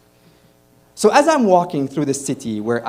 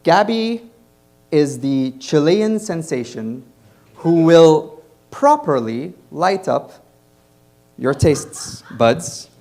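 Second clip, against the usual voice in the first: loud, building emcee introduction; announcer intro; 5 dB louder, slower than usual. Acted. Host announcing the next comic to the audience, loud and building. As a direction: hype up the next comic for the crowd.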